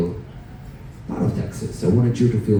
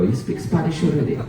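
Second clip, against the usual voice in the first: usual speaking voice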